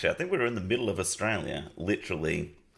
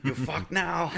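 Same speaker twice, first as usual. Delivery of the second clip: much higher, laughing taunt; gloating mechanic voice; 9 semitones higher, slower than usual. Retold or imitated. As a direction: taunt the stranded driver.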